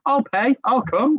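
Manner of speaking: high mock American. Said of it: "rocked up and was like".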